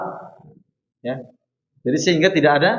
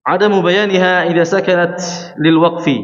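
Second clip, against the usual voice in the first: reciting rule verse; 5 semitones higher, 4 dB louder than usual. Shown as a demonstration.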